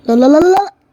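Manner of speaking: high, loud frantic protest; panicked no. Real, not acted. Gamer panicking as his character fails, frantic no. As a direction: cry out in alarm.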